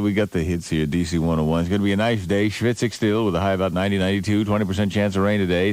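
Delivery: low, fast weather read; radio announcer. Acted.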